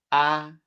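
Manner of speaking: "Ah" held high and level; "Ah" with an unwavering level pitch; first tone. Shown as a demonstration. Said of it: "let's sing ah with the four tones".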